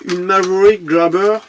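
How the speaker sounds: "Maverick Grabber" said with an English accent. Real, not acted. Reading the car name to viewers, English accent. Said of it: "Excusez-moi pour mon accent".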